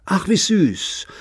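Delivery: sarcastic. Acted. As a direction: drip mock sweetness. Daughter sneers about the baby.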